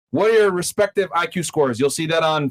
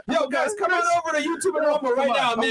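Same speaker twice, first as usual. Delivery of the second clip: high-pitched urgent call to the audience; real urgent call-out; 10 semitones higher than usual. Real, not acted. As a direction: call the viewers over, voice pitched right up. Urgently sending viewers to other platforms.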